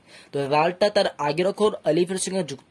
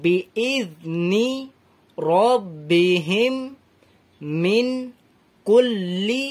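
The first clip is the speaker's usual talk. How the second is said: slow Quran recitation, word by word; measured, drawn-out teaching recitation; slow reading aloud; much slower than usual. Shown as a demonstration. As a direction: stretch the long vowels, pausing between words. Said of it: "তো এখান থেকে পড়ি".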